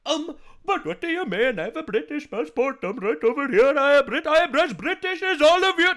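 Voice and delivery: high, pleading voice in a put-on Canadian accent; mock Canadian accent. Playing a Canadian objector in a high, put-on Canadian accent. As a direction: play a Canadian insisting he's British. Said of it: "That's a Canadian accent".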